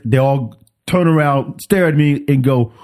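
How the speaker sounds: ordinary delivery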